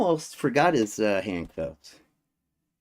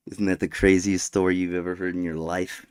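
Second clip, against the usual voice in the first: ordinary talk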